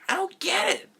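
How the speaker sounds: high, loud mock outburst; Nostalgia Critic. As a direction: screech it in exaggerated bafflement. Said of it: "Nostalgia Critic impression".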